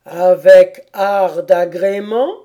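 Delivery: loud, slow questioning turtle; tortue qui interroge. A narrator's reading. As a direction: ask it weightily, rising at the end. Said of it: "demanda la tortue". (tortue qui demande lentement, fort)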